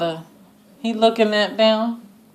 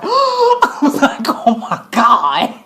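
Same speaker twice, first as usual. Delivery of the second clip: laughing in disbelief, slow; shocked laughing gasp; 6 semitones higher, 4 dB louder, slower than usual. Real, not acted. Recapping the show to viewers, gasping with laughter. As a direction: gasp then laugh out oh my God.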